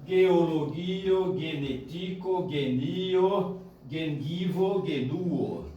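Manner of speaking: slow "Geologio, genetico" with hard g; syllable by syllable. Shown as a demonstration. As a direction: draw out every vowel in "genio, gengivo, genuo". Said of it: "tracinhos separando cada sílaba".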